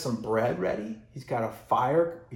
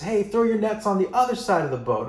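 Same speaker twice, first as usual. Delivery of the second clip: raised, higher-pitched shout to the boat; calling out; 8 semitones higher, 6 dB louder, faster than usual. Acted. Man on shore yells to the fishermen, calling out. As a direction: holler it out across the water. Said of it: "he yells out to them".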